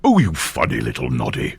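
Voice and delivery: low cartoon character voice; deep-set storybook impression; Big Ears. Shown as a demonstration. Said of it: "the Big Ears impression".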